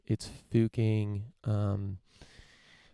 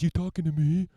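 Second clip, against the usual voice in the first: tough guy; 7 semitones higher, 5 dB louder than usual. Retold or imitated. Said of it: "This guy says".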